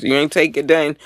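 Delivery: ordinary talk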